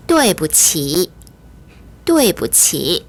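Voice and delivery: 对不起 slowly, 对 as "duei"; model pronunciation of 对不起. Shown as a demonstration. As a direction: teach 对不起 slowly with the "e" heard in 对. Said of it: "이렇게 발음을 하셔야 합니다".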